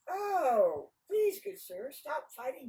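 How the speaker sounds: high, exclaimed entreaty; hammed-up polite plea; alarmed pleading. Nasrudin pleads with the dogs.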